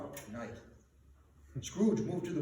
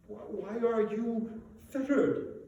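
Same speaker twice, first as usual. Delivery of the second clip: slow, stammering fearful question; trembling; 5 semitones higher than usual. A narrator's reading. Scrooge frightened before the chained ghost, trembling. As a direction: tremble as you ask. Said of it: "asked Scrooge, trembling".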